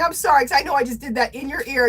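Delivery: normal talking voice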